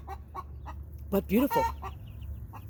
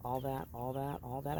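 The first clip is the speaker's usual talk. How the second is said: hushed; 5 semitones lower, 13 dB quieter than usual. Real, not acted. Chicken squawks, hushed.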